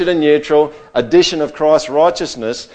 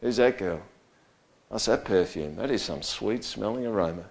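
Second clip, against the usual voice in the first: admiring murmur; 4 semitones lower, 10 dB quieter than usual. Retold or imitated. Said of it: "People going".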